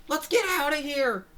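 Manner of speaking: high, loud cartoon yell; urgent shout. General ordering everyone out, urgent high shout. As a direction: call the retreat at the top of your voice. Voicing a general calling retreat.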